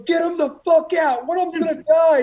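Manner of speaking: high, loud urgent shout; shouted order. Retold or imitated. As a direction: yell like a panicked drill boss. Senior orders recruits out, alarmed.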